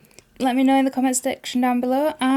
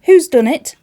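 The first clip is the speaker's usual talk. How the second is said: louder and higher, stress on Who's; angrier repeat; 6 semitones higher, 11 dB louder than usual. Acted. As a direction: shout it again, more insistent.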